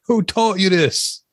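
high, drawn-out mock-stern dad; commercial dad. Co-host imitating the guy in the commercial, mock-stern dad. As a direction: imitate an indignant PSA father. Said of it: "trying to be the guy in the commercial".

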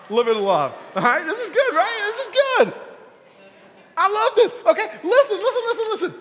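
high, loud and laughing with delight; bubbling over with joy at the room; excited. Real, not acted. Overjoyed at the group hugging.

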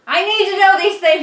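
loud, high exclamation; mock outrage. Real, not acted. Mock outrage at odd trick.

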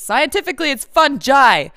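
"fungi" proclaimed as "fun-jai"; emphatic "fungi", ending in "jai"; correct pronunciation. Shown as a demonstration. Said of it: "is not right because".

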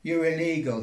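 loud robot cop barking orders; roaring policeman. Acted. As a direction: roar it through a megaphone. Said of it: "he roared through his megaphone".

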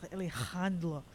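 Alejandro in a theatrical Spanish accent; Spanish accent with a throaty rasped J in Alejandro; Spanish accent act. Shown as a demonstration. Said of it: "have to say it like as an act".

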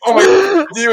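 high, loud shocked cry; panicked shocked driver. Retold or imitated. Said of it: "looks at us and is like".

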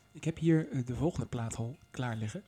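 ordinary talk, nothing marked

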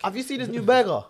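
cutting in loudly to insist about the burger. Real, not acted. Insisting over teasing.

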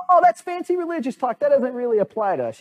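high-pitched, mocking brush-off; mock dismissal. People brush off Jesus' hard command, mock dismissal. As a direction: wave it away in a silly high voice.

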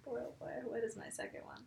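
hushed, quick thinking aloud; musing to oneself. Real, not acted. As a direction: whisper it to yourself while searching for an answer.

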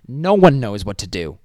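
loud, punchy comeback; emphatic retort. Acted. Answering the cocky student, loud emphatic retort. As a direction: hit it hard at normal pitch.

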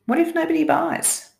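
high, anxious worried question; scaredy cat. Shown as a demonstration. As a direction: ask it like your fearful inner voice. Repeats the scaredy what-if before answering it.

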